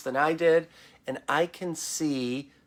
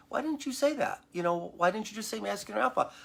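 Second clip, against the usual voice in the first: higher, curious innocent question; feigned innocence; 6 semitones higher, 4 dB quieter than usual. Retold or imitated. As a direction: play dumb, as if genuinely puzzled. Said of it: "I'm very innocent about it".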